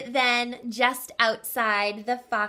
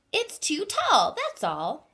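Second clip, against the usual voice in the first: high, brisk mother rabbit; matter-of-fact rabbit mum; Mrs Rabbit voice; 7 semitones higher than usual. Acted.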